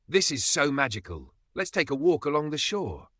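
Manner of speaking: gushing excitement. A narrator's reading. Emma gushing over the loch.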